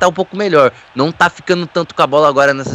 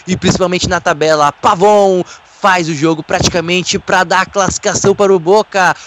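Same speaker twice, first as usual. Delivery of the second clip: usual speaking voice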